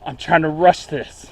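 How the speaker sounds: breathless from rushing; out of breath. Real, not acted. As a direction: talk while catching your breath. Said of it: "Forgive me if I'm out of breath".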